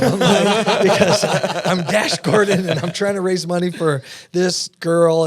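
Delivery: real laugh spilling into the words; laughing through it. Real, not acted. Retelling neighbors' question, answers laughing. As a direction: laugh as you explain yourself. Laughing while explaining costume.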